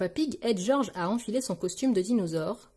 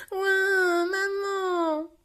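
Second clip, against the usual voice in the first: high, long drawn-out wail; acted toddler crying out for mum; wailing child; 11 semitones higher, much slower than usual. A narrator's reading. Richard calls his mum after losing his toy, wailing. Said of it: "crie Richard".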